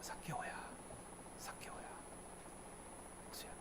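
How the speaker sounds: whispered gentle calling of a name; soft calling. Acted. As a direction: call him tenderly, almost inaudibly. Jesus calling name softly.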